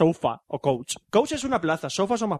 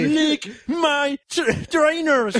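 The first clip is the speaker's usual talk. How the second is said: mock tough guy; 8 semitones higher, much slower than usual. Mock tough English insult.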